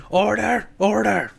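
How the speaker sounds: high, loud hammed-up "Order, order"; mock Speaker calling order. Speaker silencing the rowdy House, high loud mock bellow. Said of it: "the Speaker of the House is like".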